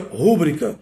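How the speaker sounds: "rúbrica" stressed on the first syllable, RÚ-bri-ca; silabada. Shown as a demonstration. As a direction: wrongly hit the "rú" in "rúbrica". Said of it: "Muita gente pronuncia". ("rúbrica" com tônica errada no RÚ)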